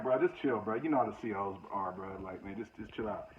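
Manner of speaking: low, hushed, urging calm; quiet warning to a hot-headed cellmate; hushed plea to chill. Retold or imitated. To the cellmate at the table, hushed and urgent. Calming cellmate in chow hall.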